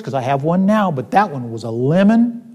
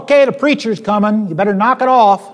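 imitating a manager's yell, higher and louder; retold yell; 6 semitones higher, 4 dB louder than usual. Manager warning his staff the preacher is coming, retold yell. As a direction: call out a mock warning to the room. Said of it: "he'd yell out".

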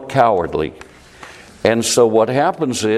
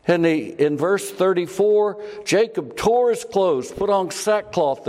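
high, fervent sermon voice; impassioned preaching; 8 semitones higher than usual. Acted. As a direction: preach it with rising passion. Preacher rises in emotion.

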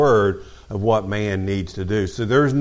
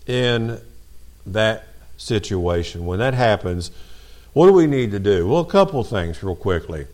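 normal talking voice

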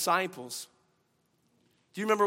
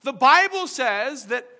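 loud, high preaching voice; fervent sermon delivery; 7 semitones higher, 9 dB louder than usual. Real, not acted. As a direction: preach it out to the church. Preaching at full force again.

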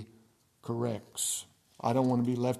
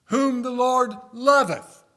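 loud and high, dragged out slowly; fervent loud proclamation; preaching cry; 12 semitones higher, 13 dB louder, slower than usual. Shown as a demonstration. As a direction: lift the whole verse up. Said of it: "verse repeated, different force".

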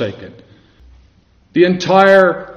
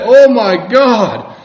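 slow, raised cry of appeal; higher, stretched-out solemn cry; reverent cry to God; 5 semitones higher, 4 dB louder, much slower than usual. Acted. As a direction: call out to God, drawing the words out. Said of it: "the context of his cry".

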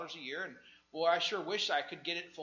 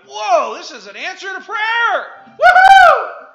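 ecstatic shout, much higher and louder; excited whoop; 27 dB louder, slower than usual. Retold or imitated. Temp hearing of a full-time job, ecstatic shouting. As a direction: whoop like a prayer was just answered. Retold joy at job opening.

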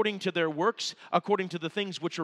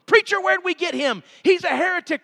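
high, loud mock outrage from the pew; mock-indignant congregant, higher and louder; outraged churchgoer; 11 semitones higher, 5 dB louder than usual. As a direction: cry heresy like a scandalised listener. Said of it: "You say".

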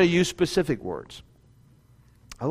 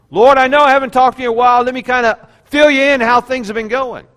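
louder, higher, rushed catch-up prayer; mock chatty prayer; 6 semitones higher, 8 dB louder than usual. Preacher mocking a believer updating God, hurried and loud. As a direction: rattle it off like news to God.

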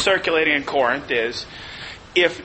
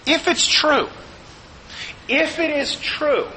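lifted, much higher pleading voice; voicing Paul; 8 semitones higher than usual. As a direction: lead them gently by the hand, pitched up. Paul reasons with the Corinthians.

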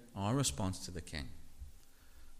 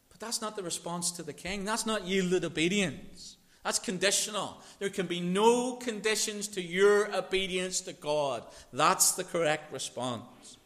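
loud, higher preaching voice; full-force preaching; 10 semitones higher, 9 dB louder than usual. Acted. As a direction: drive the point home from the pulpit.

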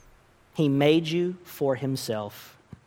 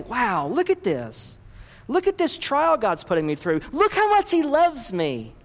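sincere wonder; 9 semitones higher than usual. Shown as a demonstration. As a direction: marvel at it and build with joy. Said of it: "But to actually say".

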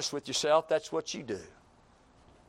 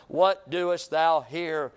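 slow solemn question; 5 dB louder, much slower than usual. Acted. Slowed divine question.

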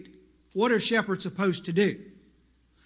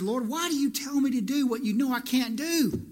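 higher, whiny grumble; grumbling complaint; 6 semitones higher than usual. Retold or imitated. Preacher retelling his complaint to the Lord, whiny. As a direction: complain to the Lord while mopping. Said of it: "grumbling and complaining".

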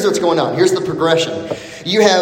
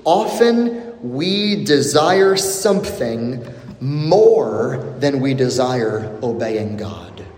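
ordinary talk, nothing marked

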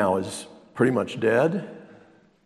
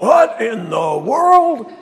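higher, loud, slow mock cry of dismay; shrill mock outrage; 11 semitones higher, 9 dB louder, much slower than usual. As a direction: stretch it out in mock horror. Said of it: "More like".

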